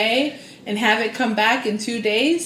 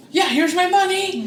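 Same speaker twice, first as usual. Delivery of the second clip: higher, louder nervous yes; frantic agreeing; 9 semitones higher, 5 dB louder than usual. Retold or imitated. Paying to escape the psychic's stare, frantic. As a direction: blurt it out to escape her stare.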